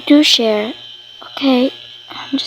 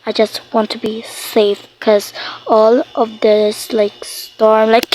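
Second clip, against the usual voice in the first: ordinary delivery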